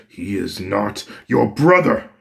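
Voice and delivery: a lightly voiced enraged father; angry denial. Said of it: "Endeavor then gets angry".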